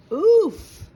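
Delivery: sudden high yelp of pain as he bumps into something; loud pained oof. A narrator's reading. Bean swaggering into a wall, pained oof. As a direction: blurt out a sharp oof. Bumping into things while swaggering.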